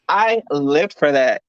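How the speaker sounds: ordinary talk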